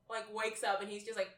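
usual speaking voice

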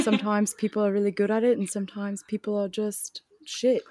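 brisk put-on British accent; playful British voice. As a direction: chat quickly in mock British. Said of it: "rest of the podcast in British".

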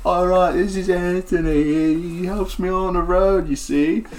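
swagger it like a washed-up rock star; laid-back bard introducing himself, cocky. Acted. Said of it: "he's like".

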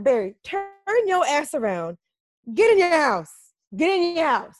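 high, emphatic scolding; mock-bossy command; exasperated order. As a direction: order him back inside, punching each phrase. Ordering character back home.

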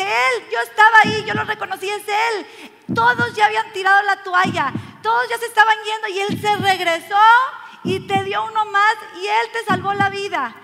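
high, loud, insistent retold shout. Husband telling his wife about the doctor, shouting. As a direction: point out the hero at full voice. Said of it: "el esposo de esta señora empieza a gritar".

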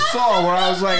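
usual speaking voice

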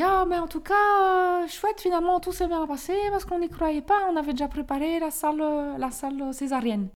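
put-on very high, loud Flemish-accented midwife; strong Flemish accent. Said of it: "elle avait un fort accent flamand". (gros accent flamand imité, aigu et fort)

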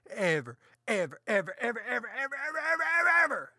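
escalating string of evers climbing up; rising evers. Acted. Escalating ever list to kids.

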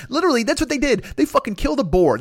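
ordinary talk

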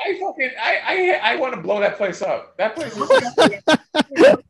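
laughing through the rant; words breaking into laughter. Real, not acted. Ranting about a hipster place, laughing.

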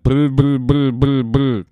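loud wet spitting bleh sounds; spitting out dip. Retold or imitated. Itchy spits out his dip, loud spitting. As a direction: spit out a mouthful of dip, noisily. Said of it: "That's them spitting out the mouthful of dip".

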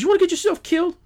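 very high, mock-outraged Southern drawl; hammed-up New Orleans indignation in dialect. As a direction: mimic a Southern driver's threat. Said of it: "The Southern drawl was just out".

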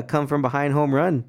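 ordinary talk, nothing marked

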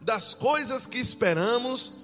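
fervent, loud preaching. Acted. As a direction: preach it with full force. Fervent scripture reading and preaching.

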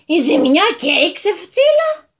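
outraged at the loss; indignant protest. A narrator's reading. Landowner protesting to the lawyer, indignant. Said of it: "με σφιγμένες τις γροθιές".

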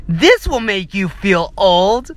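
slow, mocking sarcastic read. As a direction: sneer at the headline, drawn out.

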